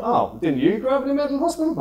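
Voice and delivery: high, surprised question; a touch of mock amazement; mock surprised friend. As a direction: play their shock. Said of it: "you tell people".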